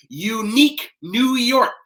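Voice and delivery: over-articulate "Unique New York", every sound crisp; exaggerated tongue twister. Shown as a demonstration. Coach demonstrating the tongue twister for the class to repeat, exaggerated. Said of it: "Everybody say it with me".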